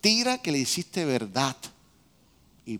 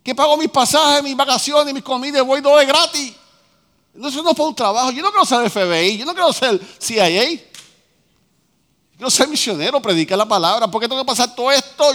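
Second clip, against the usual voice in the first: imitating his old complaint; 8 semitones higher, 7 dB louder than usual.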